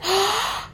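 a big sharp inhale through the mouth; deep gasp. Shown as a demonstration. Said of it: "Take a deep breath".

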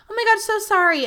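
very high, gushing mock apology; apologetic barista. Barista apologising to a waiting customer, high gushing imitation.

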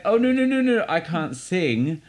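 high, hurried no-no-no protest; mock panicked refusal. Actor asked to sing for musical director, mock panic. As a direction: beg off singing in an exaggerated high voice.

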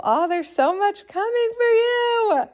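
sky-high delighted exclaim; gushing excitement. Pretend. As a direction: gush over the client's big dream. Voicing her excited reply.

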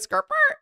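high-pitched imitated car screech; imitated car skirt-burr. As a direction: squeak out the other car's jerk. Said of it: "And he goes".

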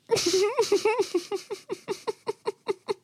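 high airy giggle at his own joke; high breathy laugh. Real, not acted. Laughing at his own guillotine joke, a high breathy giggle.

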